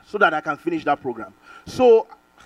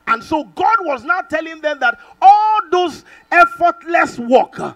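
louder, higher, slow preaching shout; preaching at full force; 10 semitones higher, 8 dB louder, slower than usual. Real, not acted. Preacher to congregation about the Israelites, loud shout. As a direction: build the sermon to a loud climax.

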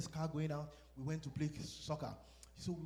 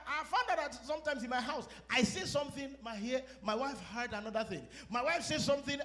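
ordinary delivery

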